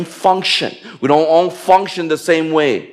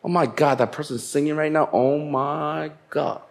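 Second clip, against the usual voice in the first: proud inner voice; 8 dB quieter than usual. Retold or imitated. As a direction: sneer it quietly to yourself, full of pride. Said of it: "gonna get proud and say".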